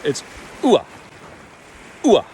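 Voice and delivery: ua clipped short, not a full ah. Shown as a demonstration. Coach isolating the first vowel for students. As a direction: say ua briefly, rising straight into the open vowel. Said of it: "It's not a full ah, because it's short".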